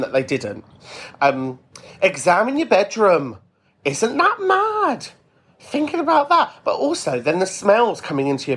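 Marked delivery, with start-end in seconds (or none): 2.0-6.5 amazed. Real, not acted. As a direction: marvel at it, pitch up. Host marvels at image.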